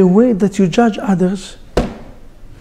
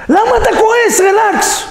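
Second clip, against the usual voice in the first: high, far more pitched-up mimicry of a fuming critic; fuming know-it-all, pitched up and pushy; mock angry scolder; 11 semitones higher, faster than usual. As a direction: play the hothead who tells others to calm down. Imitating angry people telling others relax.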